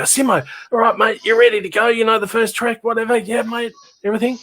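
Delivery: high, eager rapid-fire chatter; excited pep check. Retold or imitated. Musician turning to bandmate before the first track, excited. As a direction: hype up a bandmate before going on.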